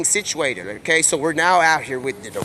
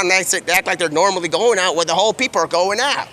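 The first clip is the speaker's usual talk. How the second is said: ordinary talk, nothing marked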